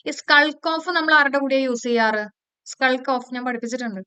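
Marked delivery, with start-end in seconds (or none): none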